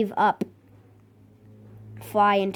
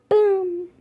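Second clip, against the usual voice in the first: very high mock explosion boom; 10 semitones higher than usual. Real, not acted. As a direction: make the blast sound. Explosion in game.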